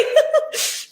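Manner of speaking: breathy high giggle; real high giggle; high laugh. Real, not acted. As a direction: let a high laugh escape. Laughs after shocked reply.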